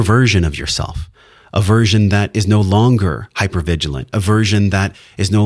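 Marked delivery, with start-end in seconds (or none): none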